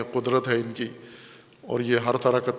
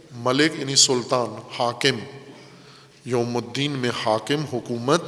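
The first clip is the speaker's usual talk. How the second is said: ordinary talk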